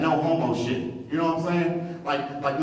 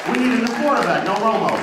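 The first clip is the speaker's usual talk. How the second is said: rally cry; 6 semitones higher, 5 dB louder than usual. Acted. Cowboy fans chant punchline.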